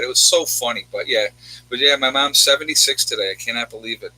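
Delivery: ordinary delivery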